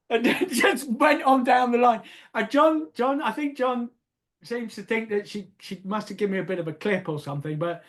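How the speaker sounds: ordinary delivery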